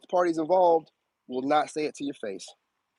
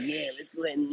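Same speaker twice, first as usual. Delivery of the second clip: softly voiced schemer, slightly hushed; conspiratorial; 8 dB quieter, faster than usual. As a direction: lightly mimic someone plotting in a corner. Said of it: "hiding in the corner".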